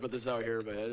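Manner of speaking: ordinary delivery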